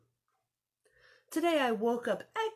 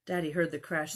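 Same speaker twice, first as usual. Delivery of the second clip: ordinary delivery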